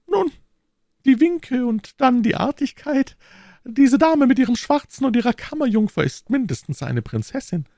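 very high falsetto lady, pointed; jealous procurator's wife. Acted. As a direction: needle him in a high wifely voice. (eifersüchtige Frau in hoher Fistelstimme)

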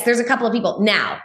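ordinary delivery

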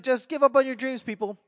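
high, mock-cheery announcement; mock advice. Mock motivational call to the audience.

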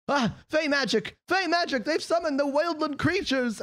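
very high-pitched panicked cries; panicked crowd. Acted. Townspeople fleeing summoned creatures, high mock panic. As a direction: cry the alarm in a high, hammed-up voice. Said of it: "a bunch of people go".

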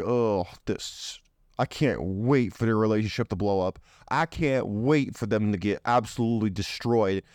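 low, scheming inner voice; secret jealous thoughts. Retold or imitated. Jealous friend secretly wishing the relationship fails, low scheming voice. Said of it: "Meanwhile, they're like".

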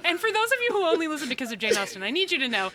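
high, fast, laughing through words; can barely talk for laughing; laughing hard. Real, not acted. Laughing hard after the joke.

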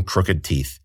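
usual speaking voice

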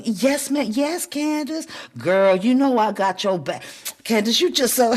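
high, syrupy friendly gushing; fake sweet flattery. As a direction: pour on fake sugar to her face. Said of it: "giving them sugar out your mouth".

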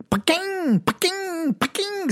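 high, ringing "pa-king" noises; imitated coin ping. Change hitting guitar over the PA, high imitated pinging. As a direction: mimic coins ricocheting off an amped guitar. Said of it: "it just starts making this".

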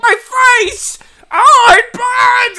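very high, loud, slow crying yell; mock pained scream. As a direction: wail like your face is on fire. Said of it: "she's like".

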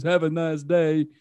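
slow Southern-drawl imitation; Forrest Gump. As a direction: stretch it out in a simple Southern man's voice. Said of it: "And he goes".

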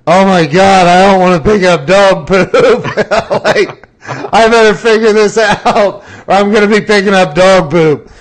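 half-horrified, half-amused; laughing mock dread; laughing mock panic.

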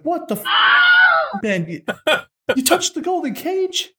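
high, loud, drawn-out disbelief; shocked outburst. Acted. Tsar's man stunned that the cage was touched, shrieking.

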